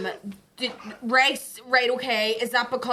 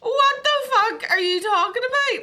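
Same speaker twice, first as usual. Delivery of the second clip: much higher, louder indignant retort; mock outrage; 12 semitones higher, 5 dB louder than usual. Wife snapping at her partner over poor food, high loud outrage. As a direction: fire it back, offended. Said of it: "I just went".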